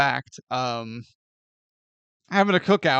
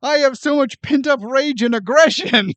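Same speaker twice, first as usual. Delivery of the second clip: eager mock battle cry; 7 semitones higher, 6 dB louder than usual. Voicing Frodo eager to fight.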